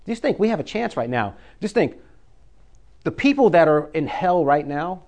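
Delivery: ordinary delivery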